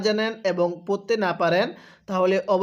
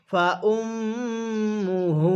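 ফা উম্মুহু হা উইয়া drawn out slowly, syllable by syllable; slow Quran teacher model reading; slow recitation demo; 2 semitones higher, much slower than usual. Shown as a demonstration. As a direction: stretch every sound of হা উইয়া for students to repeat. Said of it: "আরেকবার পড়ুন".

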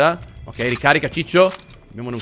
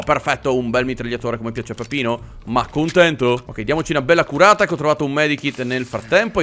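ordinary delivery

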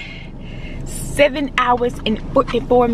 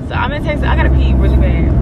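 normal talking voice

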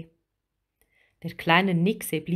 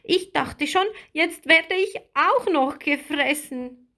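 put-on high small-girl voice; high, animated little mermaid, still rattled; small character blurting her worry; 8 semitones higher than usual. A narrator's reading. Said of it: "sagte Lilli".

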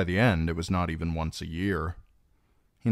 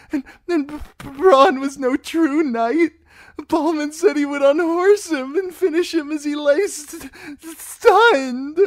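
high, loud wail with stuttered consonants; weeping; 10 dB louder than usual. A narrator's reading. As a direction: sob and stumble over the words. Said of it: "Stop that weeping".

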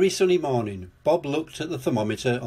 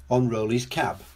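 usual speaking voice

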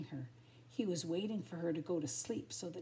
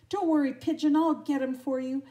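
high, loud heroic call; shouted; 7 semitones higher, 11 dB louder than usual. A narrator's reading. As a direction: shout reassurance as you dash off. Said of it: "shouted Tramp".

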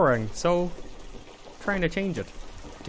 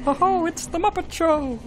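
Kermit the Frog; 4 semitones higher than usual. Acted. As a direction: announce it as a cheery puppet frog. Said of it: "It's such a fun voice".

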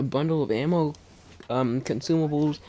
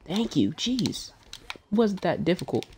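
ordinary talk, nothing marked

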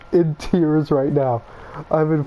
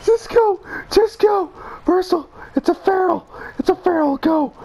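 high panicked calling out; frantic urging; 13 semitones higher than usual. Real, not acted. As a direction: yell at them to flee. Urging flight from monster.

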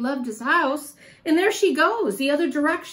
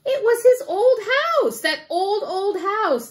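excited; 7 semitones higher, 5 dB louder than usual. A narrator's reading. Excited storytelling reveal.

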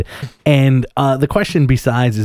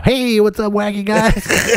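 much higher, slow, put-on goofy hero voice; hammed-up friendly act to fool everyone; fake cheery Spidey; 12 semitones higher, much slower than usual. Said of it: "better put the act on".

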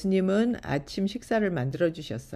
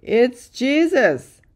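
high, loud, slow exclamation; excited shout; 7 semitones higher, 10 dB louder, much slower than usual. A narrator's reading. Peter spots Jesus from the boat, shouting. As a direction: shout it out in recognition. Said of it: "shouted Peter".

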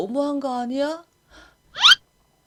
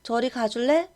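ordinary delivery

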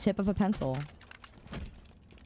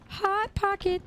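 silly voice; 12 semitones higher, slower than usual. Acted. Silly punchline voice.

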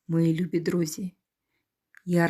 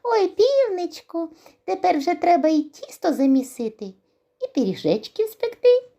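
high, loud squeaky mouse voice; happy mice; 12 semitones higher, 5 dB louder than usual. Acted. Mice ask the rooster to bake pies, high gleeful squeak. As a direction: chirp it gleefully. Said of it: "пораділи".

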